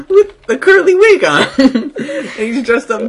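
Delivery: high, loud laughing through words. Real, not acted. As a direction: crack up while telling it. Cracking up at frog in wig.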